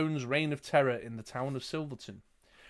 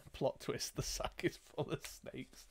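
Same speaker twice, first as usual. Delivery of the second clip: breathless giggle through the words; half-whispered while cracking up; laughing; 13 dB quieter than usual. Real, not acted. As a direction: laugh it out, barely voiced.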